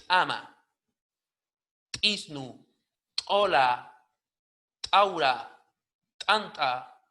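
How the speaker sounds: each word opened by a popped ejective T; explosive T. Shown as a demonstration. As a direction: punch out a hard T, then the vowel of "Ama", "Ola", "Aura". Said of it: "con una T explosiva".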